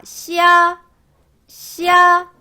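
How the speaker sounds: 시야 high and loud with the weight on 야; pinyin xia demo. Shown as a demonstration. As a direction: say 시야 as one gliding syllable. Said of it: "시, 이야".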